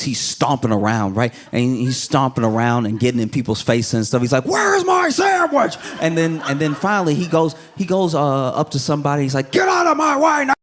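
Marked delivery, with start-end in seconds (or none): 4.4-5.8 high-pitched mock shout; mad customer. Man stomping around the Popeyes, angry demand. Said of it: "I don't know why he's so mad". 9.5-10.5 high, hammed-up angry shout; mad customer. Mad man up to somebody in his way, angry order. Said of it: "I don't know why he's so mad".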